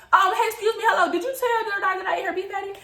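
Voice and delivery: high, hurried questioning; accosting passers-by. Retold or imitated. Teller asks the next person who told, high and insistent.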